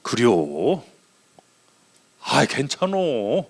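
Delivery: relaxed, low Chungcheong drawl; easygoing phone chat in soft, slow Chungcheong dialect; low, unhurried Chungcheong lilt. Retold or imitated. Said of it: "충청도 사투리로 싹 해버렸네".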